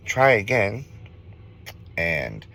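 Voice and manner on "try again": lightly voiced ghost woman, pitched higher; a higher, lightly mocking challenge; taunting ghost woman. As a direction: retell a ghost's taunt in a raised voice. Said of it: "she laughed and she says".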